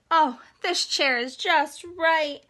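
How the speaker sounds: put-on happy girl voice, slowed down; pleased little girl. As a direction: savor the find.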